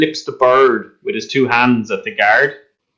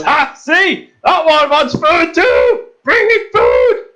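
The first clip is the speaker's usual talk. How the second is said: much higher, hammered-up beast cry; roaring beast; slower than usual. Acted. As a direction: crow it out as the beast, high and drawn out. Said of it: "and the beast goes".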